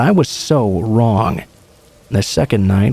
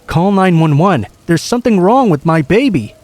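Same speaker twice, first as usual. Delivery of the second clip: higher-pitched, understated narrated scream; 7 semitones higher than usual. A narrator's reading. Frantic woman begging strangers for help, restrained screaming. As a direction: cry out urgently, without a full scream. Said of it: "She was screaming, muttering the words".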